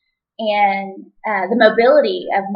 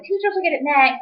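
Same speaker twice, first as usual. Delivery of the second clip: high mock official; 8 semitones higher than usual. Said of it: "they were like".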